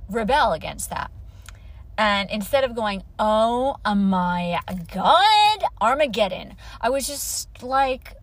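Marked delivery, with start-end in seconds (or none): 3.2-6.5 slow, drawn-out melodramatic dread; loud, stretched mock horror; mock catastrophe. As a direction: ham up the end of the world. Mock panic she says she avoided.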